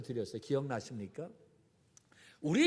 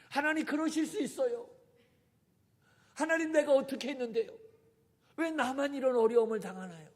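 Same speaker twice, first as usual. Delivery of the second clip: higher, louder, quicker aggrieved plea; hurt complaining prayer; 8 semitones higher, 4 dB louder than usual. Pretend. Believer with 섭섭병 complaining to God, aggrieved and higher. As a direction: whine at God as if wronged. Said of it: "섭섭병입니다".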